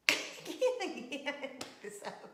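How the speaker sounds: breathless words squeezed through giggles; real giggle fit, voice giving out; helpless laughing. Real, not acted. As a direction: try to talk while cracking up. Laughing too hard to speak.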